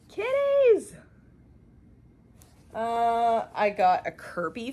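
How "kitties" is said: cooing. Real, not acted. Cooing over cat fabric.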